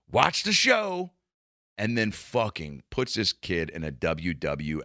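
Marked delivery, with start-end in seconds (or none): 0.1-1.1 high, slow, drawn-out taunt to the camera; mock cop showing off for the lens; menacing cop. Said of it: "looks down the barrel of the camera and goes".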